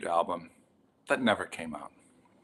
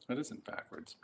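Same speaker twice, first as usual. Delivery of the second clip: whisper; 4 semitones higher, 15 dB quieter than usual. Real, not acted. Muttering about the camera view.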